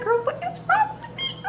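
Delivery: very high, squeaky self-mocking whine; hammed-up high wail at your own tears; mock teary squeal. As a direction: squeal it like you're about to cry at yourself. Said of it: "I was tearing up on this".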